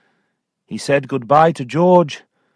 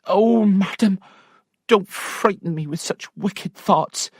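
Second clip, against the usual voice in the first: higher, alarmed servant; a touch of fright; frightened maid; 10 semitones higher than usual. Acted. Maid begging her mistress, high and alarmed.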